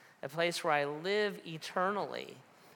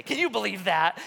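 higher and louder, amazed; incredulous; 6 semitones higher, 8 dB louder than usual. Real, not acted. Pastor to congregation, amused disbelief. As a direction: sell the disbelief.

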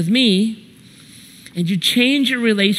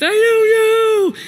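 slow, very high mock wail; hammed-up kid's bawling; crying child; 4 dB louder, slower than usual. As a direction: imitate a sobbing boy, words stretched out. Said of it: "and he's crying".

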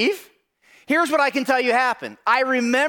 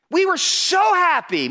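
joyful lift; 6 semitones higher than usual. Real, not acted. Exuberant recall.

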